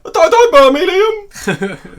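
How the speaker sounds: high, laughing, pleading squeal; mock begging girl. Reenacting the film, a high laughing plea not to be burned. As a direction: beg through giggles like a scared girl.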